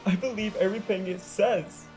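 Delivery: tearful wail to her friends. Acted. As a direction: wail out the despair. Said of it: "Sunset wailed".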